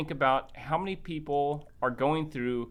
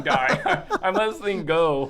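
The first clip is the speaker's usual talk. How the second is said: laughing through the words, higher and louder; 4 semitones higher, 6 dB louder than usual. Retold or imitated. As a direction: giggle it out, relieved. Laughing about letting it go.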